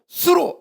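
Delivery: 수로 called out very high; Hebrew word. Shown as a demonstration. Preacher calls out the Hebrew 수로 high. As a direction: pitch up sharply on 수로. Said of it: "히브리어로".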